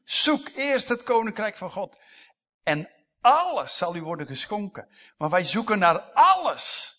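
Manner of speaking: loud, high fervent preaching; preaching at full force. Acted. (vurig en luid preken)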